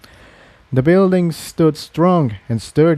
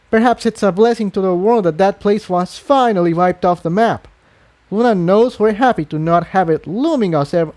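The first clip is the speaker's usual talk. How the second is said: cold disdain, higher; contemptuous; 6 semitones higher than usual. Acted. Unicorn scorning Stalingrad to the refugee mare. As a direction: dismiss the refugees coldly.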